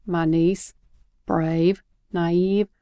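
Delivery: plain reading voice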